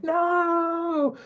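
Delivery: high, long drawn-out mock wail; retold wailing "no". Eurydice cries out behind Orpheus, high mock wailing. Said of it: "screaming and crying and her being like".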